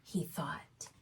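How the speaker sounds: gentle storybook reading voice; reading to children. A narrator's reading. Reads a picture book aloud.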